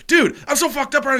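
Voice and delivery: high, loud outburst to a fellow tripper; excited, desperate confession. Retold or imitated. Two high friends after the urinal, excited confession. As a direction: blurt it out high and loud, acting very high. Retold mutual relief while very high.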